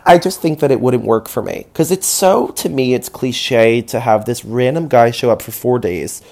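usual speaking voice

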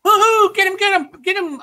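very high, loud robot whoop; excited Claptrap robot. Retold or imitated. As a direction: squeal it out like a hyped little robot. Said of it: "there's one where he's like".

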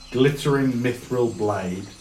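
ordinary delivery

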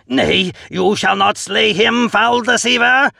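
high, loud theatrical bellow. A narrator's reading. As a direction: roar the accusation mid-fight. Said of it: "I bellow".

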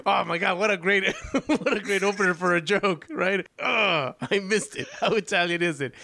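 helpless real laughter while talking; laughing. Real, not acted. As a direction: crack up at a joke you just missed. Cracking up at missed joke.

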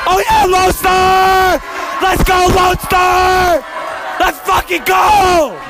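rally the crowd for your team; school cheer. Real, not acted. Cheering his school loudly.

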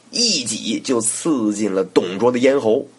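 ordinary delivery

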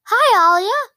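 loud, high-pitched hello; excited greeting. Acted. Dragon bounds up to its rider, excited greeting.

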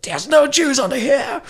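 high, loud exasperated yell; mock angry Gestapo. Gestapo searching under the table, mock mad shout. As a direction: shout it like a searcher who found nothing. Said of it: "they're, like, mad and they leave".

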